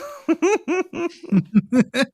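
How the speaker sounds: high cackling witch laugh; witch cackle. Acted. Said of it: "you sounded like a witch".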